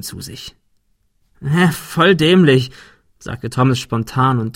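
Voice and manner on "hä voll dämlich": slow, louder self-scolding; mock self-reproach. A narrator's reading. Thomas pretends to scold himself, self-reproachful. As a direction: drag it out, higher, as if cross with yourself. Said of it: "als wollte er sich selbst rügen".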